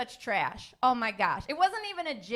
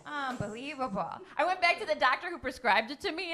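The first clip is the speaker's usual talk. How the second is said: ordinary talk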